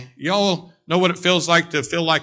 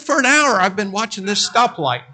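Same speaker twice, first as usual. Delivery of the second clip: imitating bystander; 6 semitones higher than usual. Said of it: "He said".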